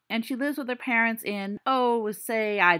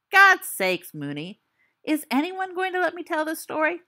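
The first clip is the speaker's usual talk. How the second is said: loud, higher, fed-up cry; exasperated outburst; 7 semitones higher, 4 dB louder than usual. Acted. Storyteller fed up with friends interrupting his tale, loud exasperation. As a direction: throw your hands up at the hecklers.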